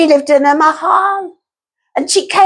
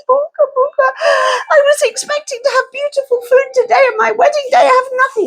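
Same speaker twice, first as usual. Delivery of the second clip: high, fast wailing complaint; wailing bride; 8 semitones higher, faster than usual. Acted. Bride wails about wedding day.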